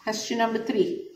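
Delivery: ordinary talk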